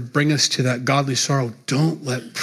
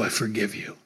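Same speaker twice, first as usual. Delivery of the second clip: "I forgive you" dropped lower and softer, fading; soft, tender forgiveness; 6 semitones lower, 9 dB quieter than usual. Acted. Jesus to Peter, the third time, softly and lower. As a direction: let the last "I forgive you" sink into a soft lower hush.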